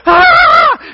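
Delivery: very high, loud acted scream; child's nightmare scream. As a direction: scream like a terrified child waking up. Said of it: "start screaming at the top of my lungs".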